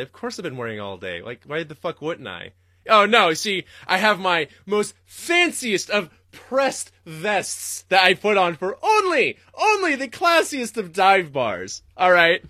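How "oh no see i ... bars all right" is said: high, loud, slow hammed-up classiness; mock fancy. Streamer mocking heckler with hammed-up posh pride. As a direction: play the snooty gentleman for laughs.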